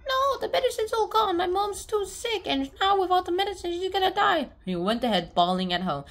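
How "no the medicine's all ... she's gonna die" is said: high wailing. Retold or imitated. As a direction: wail that mom will die. Boy wailing in despair.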